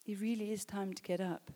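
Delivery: soft, hushed and earnest; subdued voice after a big moment; quiet reflection. Real, not acted. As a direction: let it drop to a soft, sincere aside. Soft reflection after the shouted exhortation.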